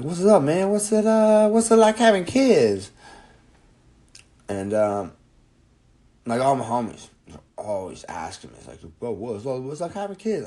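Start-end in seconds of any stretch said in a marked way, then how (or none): 0.0-2.9 much higher, louder put-on voice; squeaky mock of the big athlete; imitating football player. Player voiced asking about kids. 9.0-10.5 higher put-on bro voice; lightly mock the homies asking; imitating his friends.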